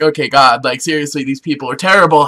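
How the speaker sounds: low, subdued complaint to God; acted weary appeal; fed-up Israelites. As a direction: grumble a mock prayer, dropping the pitch. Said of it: "the Israelites are like".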